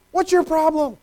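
high, sharp, impatient snap; mock impatient parent; harsh dismissal. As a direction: brush off a scared child. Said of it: "You're a terrible parent".

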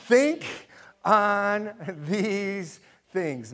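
loud high "Think", then very slow "on these things"; call-and-response. Shown as a demonstration. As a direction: lead the class to recite together. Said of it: "Read those last three words with me".

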